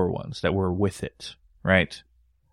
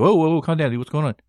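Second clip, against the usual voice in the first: startled calming; 7 semitones higher, 3 dB louder than usual. Retold or imitated. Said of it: "I was like".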